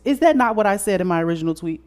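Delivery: loud, high, fast indignant challenge. Real, not acted. Host rebutting the nurse's reply to the tweet, indignant. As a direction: fire it back in disbelief.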